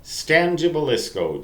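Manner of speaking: ordinary delivery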